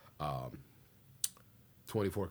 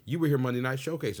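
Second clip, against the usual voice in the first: higher, louder startled receptionist; changed voice; 6 semitones higher, 6 dB louder than usual. Retold or imitated. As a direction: sound suddenly recognizing him. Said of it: "her voice changed".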